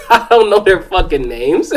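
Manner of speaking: real laughter around the words; amused storyteller barely past a laughing fit. Real, not acted. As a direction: crack up while saying it. Cracking up at his own story.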